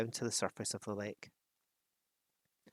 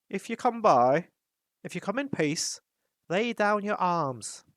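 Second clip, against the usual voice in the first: captain of the guard; 8 semitones higher, 6 dB louder than usual. A narrator's reading. Captain demanding the dwarves lay down arms, high and stern. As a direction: order them to disarm. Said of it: "said the captain".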